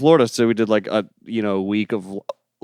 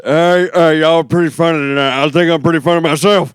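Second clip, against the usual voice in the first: put-on loud old man heckler voice; old guy voice; 7 semitones higher, 7 dB louder than usual. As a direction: play a rowdy old man at a comedy show. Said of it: "that old guy voice you do".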